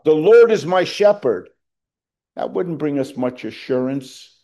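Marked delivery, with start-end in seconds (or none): 0.0-1.5 loud, high angelic proclamation; proclaimed out loud. Pretend. Preacher voicing an angel's proclamation, loud and high. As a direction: announce it like an angel from above. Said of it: "proclaimed out loud".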